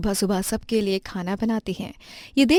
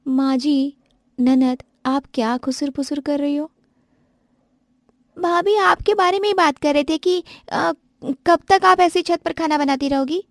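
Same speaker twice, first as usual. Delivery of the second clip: put-on higher, needling young voice; young girl needling her sister-in-law; teasing sister-in-law; 8 semitones higher, 3 dB louder than usual. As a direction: poke at the bhabhi. Characters argue about the kitchen.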